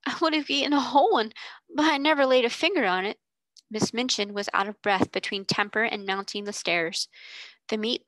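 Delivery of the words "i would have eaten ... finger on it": fast, louder, higher tearful protest; weeping. Acted. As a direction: blurt the protest out through tears. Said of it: "wept Becky".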